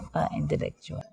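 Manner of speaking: breathy whispered repeat of "Napaka-intellectual"; whispered echo. Real, not acted. To the interviewer, echoing his own word in a whisper. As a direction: whisper it back to yourself.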